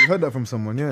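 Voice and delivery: laughing while talking; cracking up mid-sentence. Real, not acted. Laughing while quoting himself.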